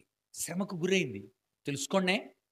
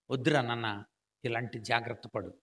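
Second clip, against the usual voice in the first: lower, gentle fatherly warning; tender plea; 7 semitones lower, 3 dB quieter than usual. Acted. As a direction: plead softly like a father to his son. Pleading with the listener as son. (తండ్రిలా మృదువుగా వేడుకోలు)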